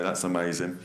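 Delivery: usual speaking voice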